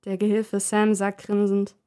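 usual speaking voice

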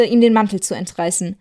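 ordinary delivery, nothing marked

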